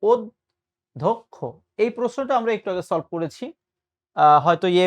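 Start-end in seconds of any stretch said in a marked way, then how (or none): 0.0-1.3 অধ্যক্ষ broken after the first syllable, round o vowels; correct pronunciation. Shown as a demonstration. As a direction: say অধ্যক্ষ very slowly, od... dhok-kho. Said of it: "এটার সঠিক উচ্চারণ হচ্ছে".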